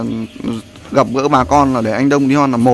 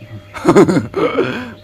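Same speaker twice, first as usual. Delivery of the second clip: amused laugh; 5 semitones higher than usual. Real, not acted. Laughing at her own joke.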